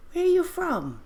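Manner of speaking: very high put-on questioning voice; imitated stock question. Mimicking that question we love, high put-on voice. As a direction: leap up high, then drop steeply at the end.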